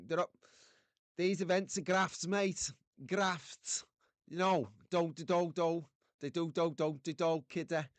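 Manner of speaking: put-on a light, playful Liverpudlian accent; hamming up a Scouse accent for the chat. Streamer jokes to the chat in a light Liverpudlian accent. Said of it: "That was, uh, Liverpudlian".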